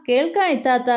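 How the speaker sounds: mild call down the line. A narrator's reading. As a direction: answer as if from up in flight. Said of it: "അവൻ വിളിച്ചു പറഞ്ഞു".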